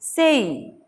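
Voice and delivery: सेई with a drawn-out glide; diphthong. Shown as a demonstration. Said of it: "हमें पढ़ना है".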